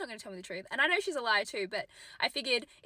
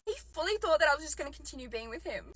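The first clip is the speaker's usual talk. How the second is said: ordinary talk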